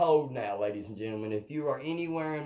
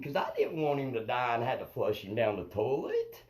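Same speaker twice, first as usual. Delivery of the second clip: ordinary talk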